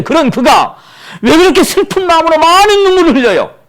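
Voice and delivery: high, loud preaching cry; impassioned shouted question. Real, not acted. Preacher challenges the congregation about Paul's tears, shouting. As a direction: throw the question out at full force.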